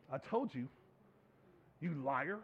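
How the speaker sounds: low, soft, deliberate rebuke; quiet accusation. Acted. Jesus looking at Peter after the denial, quietly accusing. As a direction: deliver the accusation softly, with long pauses.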